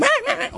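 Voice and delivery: high mock cries; mock shouting. Woman cries out as he does kaparot, high mock cries. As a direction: squeal high and short. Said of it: "she's shouting".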